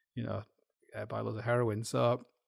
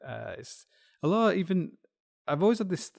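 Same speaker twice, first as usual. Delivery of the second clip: usual speaking voice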